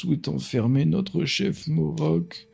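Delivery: slow, lightly voiced village elder; old man voice. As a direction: read the elder's plea gently. Reads elder NPC dialogue.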